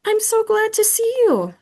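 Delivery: very high squeaky hamster voice; squeaked. Acted. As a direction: squeak it out in delight. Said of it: "I squeaked".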